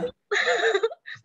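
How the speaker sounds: high squealing laugh. Real, not acted. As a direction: laugh out loud, high and bright. Laughing after mock plea. (tawa melengking)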